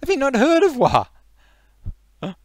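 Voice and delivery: very high, loud, stretched-out question; incredulous shout. Acted. Geordie repeating himself to the ignorant, outraged shout. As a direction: holler it slowly in Geordie outrage.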